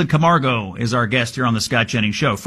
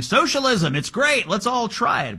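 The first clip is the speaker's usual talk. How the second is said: mocking candidates; 9 semitones higher, 5 dB quieter than usual. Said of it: "candidates saying".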